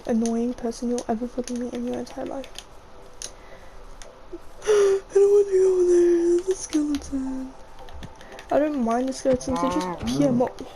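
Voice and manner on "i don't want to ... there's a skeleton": sharp gasp, then high frightened protest; scared gasp, then a high worried plea; startled gasp. Real, not acted. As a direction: jolt back from the skeleton. Startled by skeleton in game.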